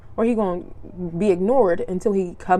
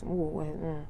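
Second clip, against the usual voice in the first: normal talking voice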